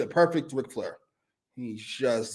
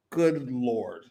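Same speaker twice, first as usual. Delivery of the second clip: normal talking voice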